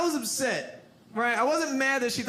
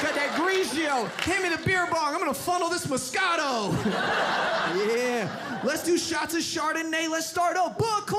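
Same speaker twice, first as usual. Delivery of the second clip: party bro shouting; 5 semitones higher than usual. Retold or imitated.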